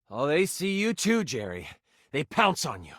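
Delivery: ordinary talk, nothing marked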